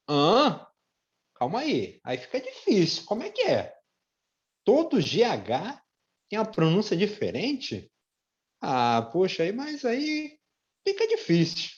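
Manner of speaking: high, far mock voice of a confused student. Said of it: "Você pode ter pensado assim". (estudante confuso, voz bem mais aguda de brincadeira)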